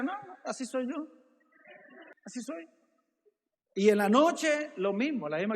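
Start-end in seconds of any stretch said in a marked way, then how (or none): 0.0-2.7 quiet, sullen and a little higher; sulky denial. Acted. Asked about her face, she sulks quietly and denies it. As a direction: brush off the question while clearly upset. Sulky person denying being angry.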